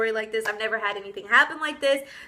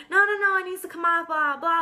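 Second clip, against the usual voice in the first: higher, rapid-fire mimicked brush-off; dismissive technician; 6 semitones higher than usual. Nail technician insisting it must come off, dismissive. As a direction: rattle it off high and quick, waving away objections.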